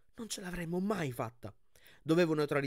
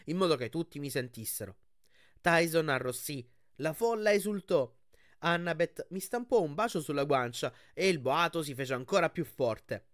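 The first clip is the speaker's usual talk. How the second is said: usual speaking voice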